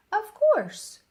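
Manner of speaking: high, loud, eager "of course"; the doctor bursts out with high delight; delighted old doctor. Acted. Kindly doctor eagerly reassures Nate, high and bright.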